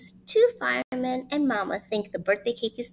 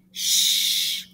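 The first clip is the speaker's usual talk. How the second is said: hissing shush; loud shush; 5 dB louder than usual. Acted. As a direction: shush them hard. Hushing so mama stays asleep.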